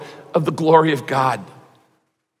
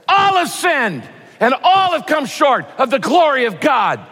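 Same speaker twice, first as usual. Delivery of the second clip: high, shouted in anger; hammed-up angry preacher yelling the verse; 8 semitones higher than usual. Shown as a demonstration. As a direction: bellow the verse at them angrily. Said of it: "I could shout it to you in anger".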